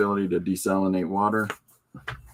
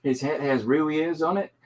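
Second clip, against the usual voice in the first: put-on higher-pitched grade-school kid's voice; put-on child voice, pitched up; kid reading aloud; 5 semitones higher than usual. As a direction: read it out like a little boy's book report. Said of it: "trying to capture that voice of a kid".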